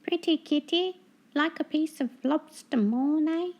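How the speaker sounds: high, mocking gull voice; teasing seagull. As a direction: taunt the seasick cat sweetly.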